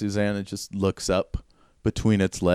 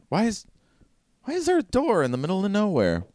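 Perry's voice; 12 semitones higher, faster than usual. Acted. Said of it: "Perry's like".

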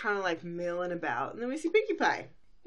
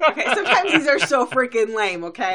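laughing; 8 semitones higher, 12 dB louder than usual. Real, not acted. Laughing at lame lesson.

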